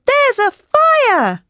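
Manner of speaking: very high, drawn-out cry of alarm; screamed warning. A narrator's reading. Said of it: "I screamed".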